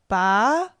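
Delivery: Ba rising upward; second tone. Shown as a demonstration. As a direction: let Ba climb. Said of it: "Here are the four tones".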